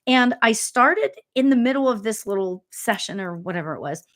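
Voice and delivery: ordinary talk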